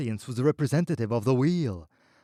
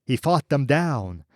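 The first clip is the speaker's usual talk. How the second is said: usual speaking voice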